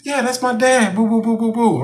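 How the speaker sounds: high-pitched mocking girl's taunt. Young manager showing her dad on her phone, high taunting. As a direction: tease like a bratty kid, pitched high. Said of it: "the whole taunting".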